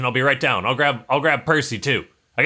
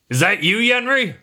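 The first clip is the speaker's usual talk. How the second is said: Grim on the intercom; 8 semitones higher than usual. Acted. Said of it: "He's like".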